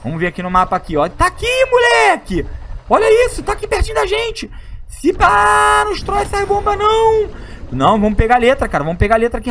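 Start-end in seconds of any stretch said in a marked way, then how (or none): 1.1-7.3 excited squeal. Real, not acted. Finds letter on map.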